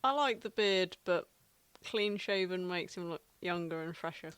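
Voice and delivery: ordinary delivery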